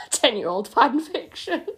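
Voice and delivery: laughing through the words, slow. Real, not acted. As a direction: crack up at yourself. Laughing at own fanfic.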